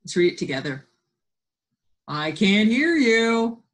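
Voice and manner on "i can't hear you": louder, higher, drawn-out coaxing; a playful "I can't hear you" to get the class reading; playful call. Real, not acted. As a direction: tease them into reading aloud. Teacher coaxing kids to join in.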